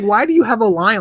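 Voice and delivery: normal talking voice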